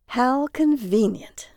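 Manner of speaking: sarcastic. A narrator's reading. Lodger answering directions to the house, sarcastic. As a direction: draw it out with dry sarcasm. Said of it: "Jaron said sarcastically".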